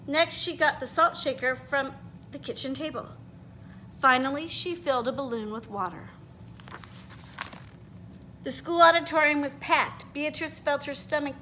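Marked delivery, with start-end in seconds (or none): none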